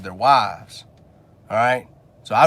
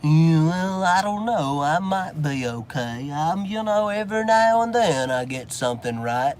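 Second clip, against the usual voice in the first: mock timid voice; 3 semitones higher than usual. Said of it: "lives in this little land of".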